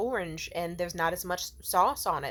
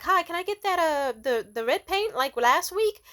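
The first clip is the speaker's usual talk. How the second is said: high, louder hopeful request; polite customer; 9 semitones higher, 6 dB louder than usual. Retold or imitated. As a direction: ask the vendor politely, pitched up. Retold polite order to vendor.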